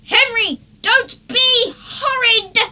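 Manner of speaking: high, loud slow tearful scream. A narrator's reading. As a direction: scream in exasperation. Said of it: "screamed his mum".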